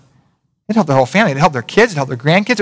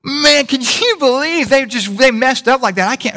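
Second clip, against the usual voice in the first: much higher, slow scandalised gasp; shocked gossip; 9 semitones higher, slower than usual. Retold or imitated. Gossiping churchgoer about a fallen brother, high scandalised. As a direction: play the churchgoer aghast at a sinner. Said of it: "you wouldn't say it like that".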